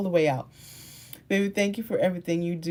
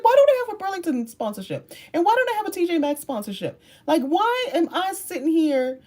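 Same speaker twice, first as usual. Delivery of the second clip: mock indignant; 10 semitones higher than usual. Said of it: "I just got mad".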